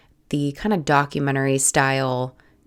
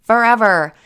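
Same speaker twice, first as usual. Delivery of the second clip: exasperated outburst; 7 semitones higher, 5 dB louder than usual. Retold or imitated. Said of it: "And I'm like".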